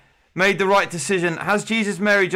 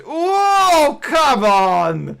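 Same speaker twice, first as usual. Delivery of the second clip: exasperated; 9 semitones higher, 5 dB louder, much slower than usual. Real, not acted. Host frustrated by missing answer.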